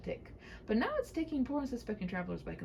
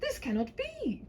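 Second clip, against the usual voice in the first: knight cries out; slower than usual. Acted. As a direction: cry out in dismay. Knight protests.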